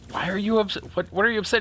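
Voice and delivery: high, bewildered retold question; baffled. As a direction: play himself confused by her tears. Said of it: "I'm, I'm like".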